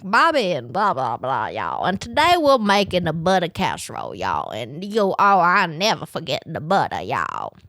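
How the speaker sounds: playful Southern TV chef impression, y'all sunk way down; Paula Deen impression. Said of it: "It's like".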